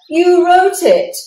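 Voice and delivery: loud accusing retort; protesting. Acted. Bear accuses Mouse loudly, indignant. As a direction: throw the blame, loud. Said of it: "protested Bear".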